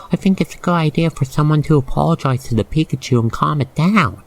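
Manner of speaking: plain reading voice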